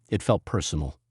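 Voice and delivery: plain reading voice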